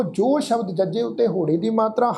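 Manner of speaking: ordinary delivery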